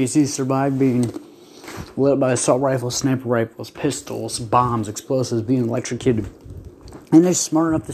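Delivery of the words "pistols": normal talking voice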